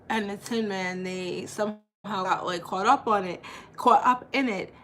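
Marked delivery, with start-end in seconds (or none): none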